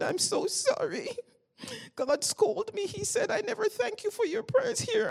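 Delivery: very high, hammed-up crying voice; mock wail of apology; squeaky pitch, imitating a sobbing apology; tearful apology. Acts out tearful apology to woman.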